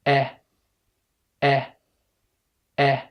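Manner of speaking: "أ" as short eh, a very light kasra; isolated short vowel "أ", as in set; short English E. Shown as a demonstration. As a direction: open the mouth slightly for a brief "أ" like eh. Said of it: "الكسرة خفيفة جدًا".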